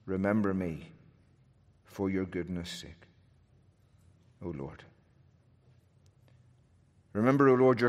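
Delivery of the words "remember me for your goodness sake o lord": drops to a soft, low murmur; fading prayer. Acted. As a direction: let the plea die away.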